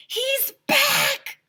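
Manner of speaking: high, loud excited yell; excited shout. Acted. Child spotting the ice cream truck, shouting with joy. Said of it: "I shouted".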